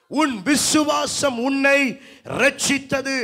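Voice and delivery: high, loud pulpit voice; full-force preaching. Acted. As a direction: drive the Bible story towards the congregation, high and insistent. (உரத்த குரலில் பிரசங்கம்)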